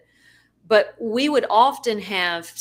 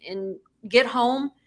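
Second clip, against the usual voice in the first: ordinary talk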